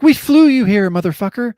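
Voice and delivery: high, mock-indignant outburst; imitating mad cops. Cops scold the pathologist, mock angry high rant. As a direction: play the fed-up cop, hammed-up. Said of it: "'cause I would be mad too".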